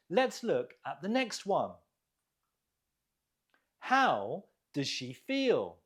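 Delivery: usual speaking voice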